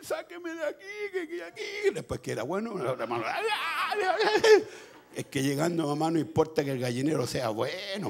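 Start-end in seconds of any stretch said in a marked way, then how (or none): 0.0-1.9 hammed-up child's wail for rescue; desperate pleading. Boy running to hug his mother, wailing to be taken away. 3.3-4.6 high-pitched repeated wail; hammed-up childlike wailing, swelling louder; mock wailing. Boy clinging to his mother, mock wailing.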